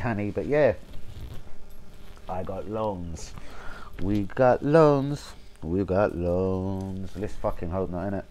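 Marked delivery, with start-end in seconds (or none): 2.3-7.1 put-on Spanish accent, very slow, each word stretched; mock a foreign coach, dragging every word out; slow, exaggerated Spanish-accented drawl; drawn-out Emery impression. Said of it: "Emery a couple of se".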